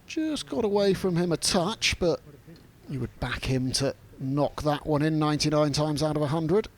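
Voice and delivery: normal talking voice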